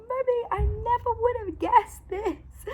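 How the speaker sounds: high, slowed, near-tearful delight; a kid almost crying with happiness; overjoyed child. Retold or imitated. Mum imitating her son thanking her for the surprise, overjoyed.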